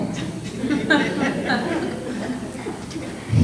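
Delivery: high, soft chuckle; real laugh. Real, not acted. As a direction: laugh lightly at your own joke. Laughs at own cuckoo joke.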